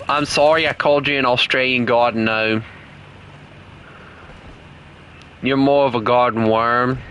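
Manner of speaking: put-on Aussie accent, teasing a friend; Australian accent. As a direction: rib him in mock Australian. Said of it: "keep on talking in this, uh, this accent".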